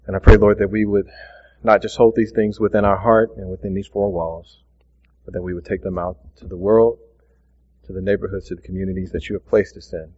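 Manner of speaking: low, soft, hushed prayer voice. Real, not acted. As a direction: pray quietly and earnestly, fading toward amen. Closing prayer before the sermon.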